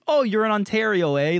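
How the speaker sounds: high, loud mocking read of the message; hammed-up customer service voice; mock exchange notice. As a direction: mimic the exchange snidely with a Canadian "eh". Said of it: "the South Korean exchange goes".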